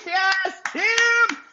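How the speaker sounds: high, thrilled calls of thanks; excited cheering. Real, not acted. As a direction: cheer the name out. Cheering a viewer's llama award.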